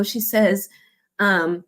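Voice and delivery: ordinary talk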